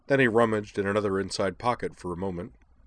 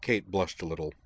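usual speaking voice

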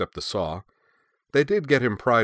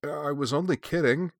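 higher-pitched, feeble self-defense; sheepish, raised-pitch protest; lame excuse; 8 semitones higher than usual. A narrator's reading. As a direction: excuse yourself weakly. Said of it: "said Truda lamely".

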